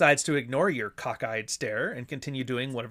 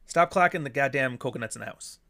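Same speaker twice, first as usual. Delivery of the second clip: ordinary talk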